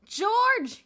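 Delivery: loud furious shout across the door. A narrator's reading. Bruno at George's door, shouting. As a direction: yell his name through the door. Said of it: "he shouted".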